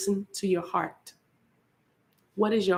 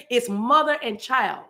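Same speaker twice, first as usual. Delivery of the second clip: ordinary talk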